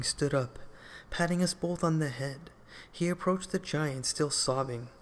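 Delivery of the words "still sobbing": ordinary delivery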